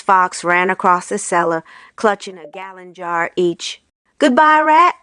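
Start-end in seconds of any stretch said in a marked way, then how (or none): 4.2-5.0 calling back cheekily, a touch higher; a cheeky farewell called over the shoulder. A narrator's reading. Foxes calling goodbye to Rat while running off, cheeky. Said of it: "they called out".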